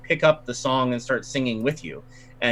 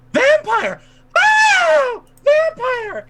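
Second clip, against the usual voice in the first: shrieking falsetto, much higher and louder; hammed-up terrified scream of "vampire"; 7 dB louder, much slower than usual. Woman accusing the bard in front of the crowd, shrieking high. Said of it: "she keeps screaming".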